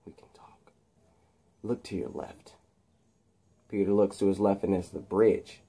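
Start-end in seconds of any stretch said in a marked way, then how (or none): 0.1-2.5 soft whispered threat; hushed sinister whisper. Acted. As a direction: whisper it menacingly.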